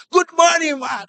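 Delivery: high, loud hammed-up greeting; front desk greeter. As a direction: mimic a receptionist greeting a guest. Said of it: "for to greet guests".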